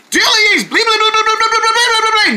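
high pleading babble; squeaky begging bug. Acted.

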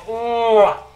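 exasperated wail; frustrated groan. Real, not acted. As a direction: groan out the loss. Groaning over the lost match.

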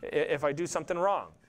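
normal talking voice

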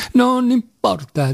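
high, drawn-out interjection; sudden high-pitched objection; sudden outburst. A narrator's reading. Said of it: "disse Ilyusha all'improvviso".

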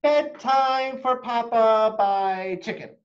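slow, loud, grand announcement; announcing a title. Acted. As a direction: present the title proudly. Child announces her own story title.

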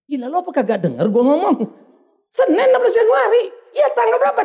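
kesal meledak, keras dan tinggi; marah. Retold or imitated. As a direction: menirukan suami yang membentak jengkel. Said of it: "saya mulai agak marah". (marah sambil meninggikan suara)

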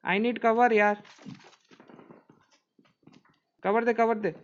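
urgent loud callout; cover me shout. Real, not acted. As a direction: yell for cover under fire. Calling for cover in firefight.

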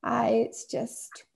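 slow, trailing off, a touch tearful. A narrator's reading. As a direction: let the words fade out, holding back tears. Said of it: "her voice trails off".

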